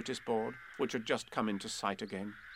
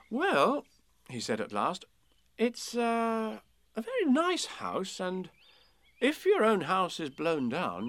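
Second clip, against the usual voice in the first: put-on boy's voice; 8 semitones higher, 4 dB louder than usual. A narrator's reading. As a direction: speak as a cheerful small boy. Christopher Robin voiced.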